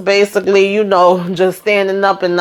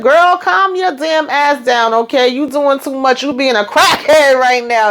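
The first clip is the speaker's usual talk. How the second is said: high-pitched mock exasperation; exasperated scolding; 8 semitones higher than usual. Husband tells begging wife to calm down, mock exasperated scolding.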